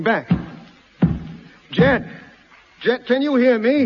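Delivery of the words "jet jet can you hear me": high, raised call through a barrier; urgent, projected hail. Acted. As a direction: shout to be heard behind a hatch. Calling through closed hatch.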